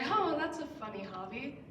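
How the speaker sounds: lightly imitated scoff; a touch of dismissive scorn, quoting a doubter; mock scoffing. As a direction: scoff a little at someone's dream. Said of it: "they scoff and say".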